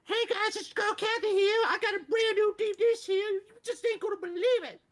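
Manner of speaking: very high, loud parody infomercial host; Kathy. Retold or imitated. Host selling a pan in a parody dub, shrieky high voice. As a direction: squeal it like a dubbed pitchwoman. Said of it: "her name is Kathy, and she goes".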